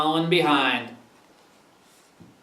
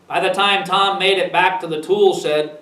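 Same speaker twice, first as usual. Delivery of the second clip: usual speaking voice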